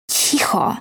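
voiceless hushing hiss. A narrator's reading. Daisy silences her crying friend, a whispered hiss. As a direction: shush them in a sharp whisper. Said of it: "syknęła Daisy".

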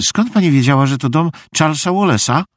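high, quickening puzzled question; surprised. A narrator's reading. Meg startled the visitor knows the house, surprised. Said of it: "zdziwiła się Meg".

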